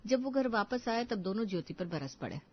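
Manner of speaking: ordinary delivery, nothing marked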